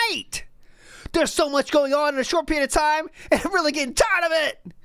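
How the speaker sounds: high, whiny imitation of an overwhelmed streamer; seething streamer. Reading out the streamer's seething message, mock whiny high voice. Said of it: "He is really seething".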